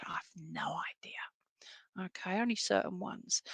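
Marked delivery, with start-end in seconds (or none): 0.1-2.1 breathy whispered confession; whispered aside. Real, not acted. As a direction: drop to a whisper, half laughing.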